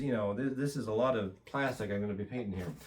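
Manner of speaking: ordinary delivery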